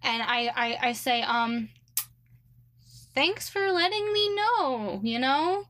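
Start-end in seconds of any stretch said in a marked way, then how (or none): 3.1-5.0 high, drawn-out mock gratitude; sarcastic thanks, pitched up and slowed. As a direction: stretch it out high, dripping with sarcasm. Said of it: "Like, very sarcastically".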